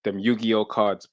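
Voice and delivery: ordinary talk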